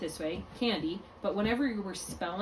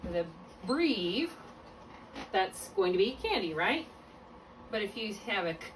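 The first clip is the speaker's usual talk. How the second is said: ordinary delivery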